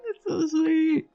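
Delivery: high squeaky fake cry; mock sob. Gamer mock-crying at a sweet cutscene, high fake sob. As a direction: pretend to weep over the cute moment.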